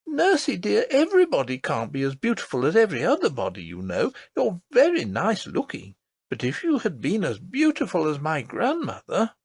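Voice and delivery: put-on high, earnest child's voice; a small girl insisting, wide-eyed; little princess voice. A narrator's reading. Princess tells her nurse about the lady, earnest.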